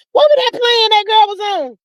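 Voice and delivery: can't-remember outburst; exasperated. Real, not acted. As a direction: fling the question up high. Searching for a word, exasperated.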